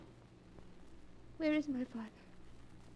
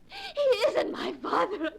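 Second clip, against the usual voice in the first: tearful triumph; 10 semitones higher, slower than usual. Acted. Girl's vindication, near tears.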